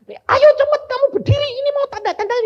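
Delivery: high, loud, hurried command; mock impatient order. Preacher imitating what the Lord never said, high, loud and impatient. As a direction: bark it like an impatient boss. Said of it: "Tuhan ini nggak bilang gini".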